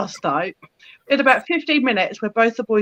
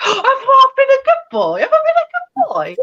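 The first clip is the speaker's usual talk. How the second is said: put-on much higher, giddy and laughing little boy voice; proud cat voice; 4 dB louder than usual. Owner voicing hyper Zach after his claws, high giddy voice. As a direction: squeal it like an overexcited pet. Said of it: "he's like".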